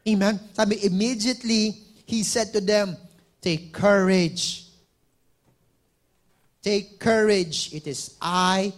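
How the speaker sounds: loud, rising preacher's call; fiery sermon delivery; full-force preaching. Acted. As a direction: preach it out to the whole room. Preaching the Bible passage.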